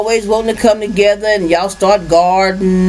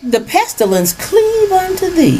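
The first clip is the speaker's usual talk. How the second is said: high, slow prophet reading; mock biblical prophet; 6 semitones higher, slower than usual. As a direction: intone it like an old prophet. Reads scripture high and slow.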